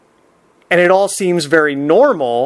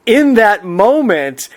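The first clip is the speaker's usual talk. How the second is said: usual speaking voice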